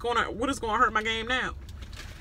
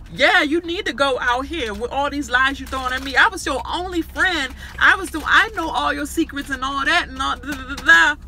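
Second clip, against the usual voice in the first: mocking Bella; 5 semitones higher, 3 dB louder than usual. Mimicking Bella's accusations.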